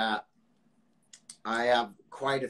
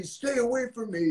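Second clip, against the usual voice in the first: mock angry, pitched well higher; hammed-up hostile brush-off; pissed off thug; 9 semitones higher than usual. Imitating the thug shoving him off, mock angry. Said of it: "the most pissed off person".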